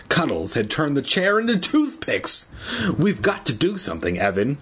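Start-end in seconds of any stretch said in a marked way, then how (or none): none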